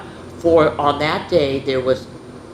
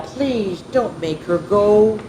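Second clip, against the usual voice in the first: slow pleading; 3 semitones higher, slower than usual. Acted. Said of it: "said Miss Clavel".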